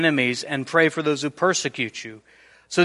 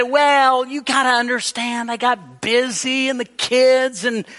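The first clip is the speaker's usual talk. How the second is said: flaky excuse-maker; 8 semitones higher than usual. Retold or imitated. Flaky friend excusing himself.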